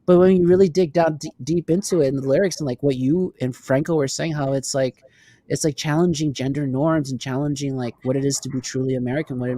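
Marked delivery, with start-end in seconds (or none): none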